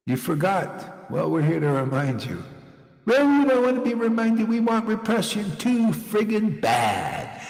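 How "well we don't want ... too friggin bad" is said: high, whiny mock refusal; mock stubborn crowd. Preacher mimics reluctant devotees in a high whiny voice. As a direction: act out the people who won't be reminded. Mocking those who refuse reminding.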